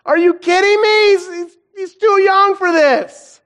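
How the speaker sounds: high, loud, slow cry of disbelief; anguished plea to God. Retold or imitated. Father recalling his sick son's relapse, crying out high and loud. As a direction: wail it at the heavens, half crying. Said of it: "I was like".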